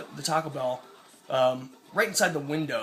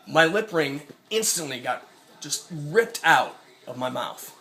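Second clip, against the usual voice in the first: ordinary talk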